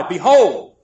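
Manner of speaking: loud proclaiming of scripture; preacher proclaiming the Lion of Judah loudly; preaching. Acted. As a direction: build it like a herald's announcement. Proclaims scripture at full force.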